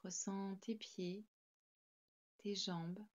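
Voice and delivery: ordinary talk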